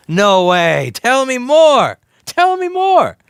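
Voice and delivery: loud, very high mock excitement; sarcastic amazement. Streamer mocks obvious news about uncomfortable laptop, screechy feigned amazement. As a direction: yell it with exaggerated fake wonder, stretching the words.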